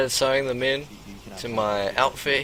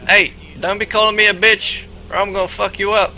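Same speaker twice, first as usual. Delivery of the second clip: threatening; 6 semitones higher, 5 dB louder than usual. Retold or imitated. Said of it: "he's like".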